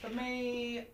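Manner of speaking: ordinary delivery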